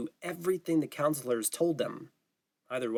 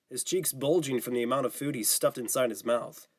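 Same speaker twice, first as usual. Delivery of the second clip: usual speaking voice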